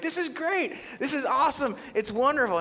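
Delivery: high, gushing delight; squealing kid thrilled with a present; ecstatic. Retold or imitated. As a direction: gush like a kid who got his dream toy. He's ecstatic.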